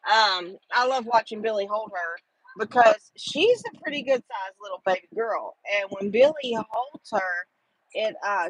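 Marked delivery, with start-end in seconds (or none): none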